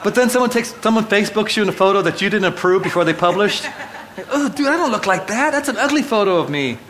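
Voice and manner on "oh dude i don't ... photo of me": high, indignant mock protest; mock dismay. Friend tagged an ugly photo, he protests in high mock dismay. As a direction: act scandalised by the photo.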